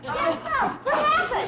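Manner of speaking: alarmed call. Acted. Granny calls out in alarm at Jethro's cry. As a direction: snap out the question in alarm.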